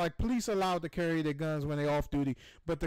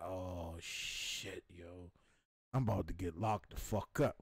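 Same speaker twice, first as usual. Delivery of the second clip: much lower, much softer muttered dread; 12 semitones lower, 7 dB quieter, slower than usual. Retold or imitated. Realizing he'll be arrested, low soft dread. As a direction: sink into the fear of getting locked up.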